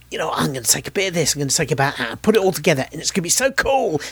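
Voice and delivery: mock teen bro, building to giddy hype; teenage comic-book bro. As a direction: gush like an overexcited fourteen-year-old. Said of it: "like a fourteen-year-old comic book bro".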